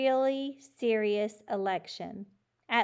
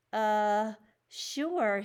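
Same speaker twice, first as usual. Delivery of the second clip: unsure; 4 dB louder than usual. A narrator's reading. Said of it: "he didn't look too sure".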